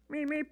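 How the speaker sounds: soft high cutesy meep; tiny polite horn toot; happy little beep. Retold or imitated. Polite driver at green light, soft high meep. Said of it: "Just a happy little beep".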